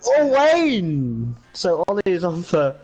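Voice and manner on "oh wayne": sudden high shocked outcry; surprised cry. Real, not acted. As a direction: yelp as a rival crashes out. Driver Wayne crashes out.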